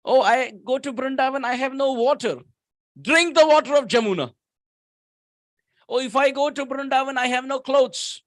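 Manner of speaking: a hint of a fretful seeker; mild worried pilgrim. Preacher voicing a devotee's excuses about Vrindavan.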